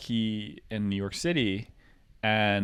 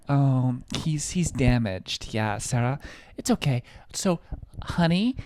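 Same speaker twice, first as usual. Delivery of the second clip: higher, sing-song talking down; patronizing; 5 semitones higher than usual. Retold or imitated. Rich girl patronizing the confused waiter, higher and sweet. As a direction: talk to him like he's slow. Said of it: "she was talking to me like".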